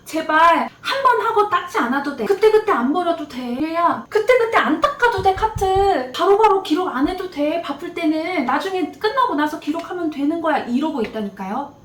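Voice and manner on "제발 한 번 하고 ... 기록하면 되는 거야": higher, pleading voice of a patient preceptor coaxing a newbie; 애원하듯 달래며 — gently begging the newbie to relax. Retold or imitated. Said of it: "걔는 이래요". (신규에게 사정하듯 높은 목소리로 달래는 프리셉터)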